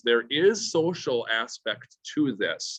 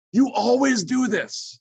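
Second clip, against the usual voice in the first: higher, louder, slow accusing parent; 12 semitones higher, 5 dB louder, slower than usual. Shown as a demonstration. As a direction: throw the blame like a frustrated parent. Parent accusing, absolute statement.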